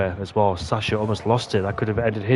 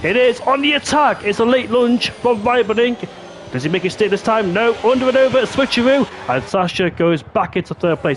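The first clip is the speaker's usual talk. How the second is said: high-pitched live race commentary; excited play-by-play; 10 semitones higher than usual. Real, not acted. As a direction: call the wheel-to-wheel action with urgency.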